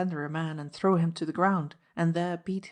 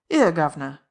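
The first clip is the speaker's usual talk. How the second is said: loud Cockney call of protest; a servant's loud Cockney outcry; cockney cry; 8 dB louder than usual. A narrator's reading. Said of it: "he cried".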